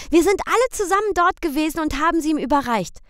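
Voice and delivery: put-on high, fast excited child; eager children. A narrator's reading. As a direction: tumble out the story like a thrilled kid. Children chime in.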